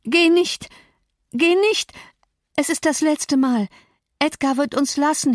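very high, pleading woman's voice; desperate cry. A narrator's reading. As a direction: beg him frantically to stay. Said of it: "schrie sie". (verzweifelt flehend, sehr hohe Stimme)

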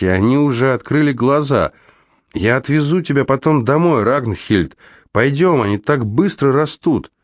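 high, animated coaxing voice; eager coaxing. A narrator's reading. Раймонд заманивает девочку в машину. (заманивая, оживлённо и высоко)

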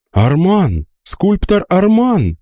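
high, startled exclamation; astonished cry. A narrator's reading. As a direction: cry out the name in shock. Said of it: "раздалось с разных сторон".

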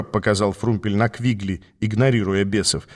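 plain reading voice

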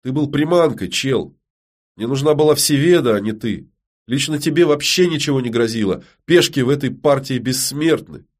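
mildly scornful, forceful rebuke; angry. A narrator's reading. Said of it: "зло бросила Ярина".

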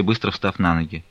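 plain reading voice

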